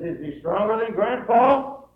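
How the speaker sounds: put-on high, slow child's question, swelling loud; astonished boy asking about Grandpa; little boy. Little boy questions his father.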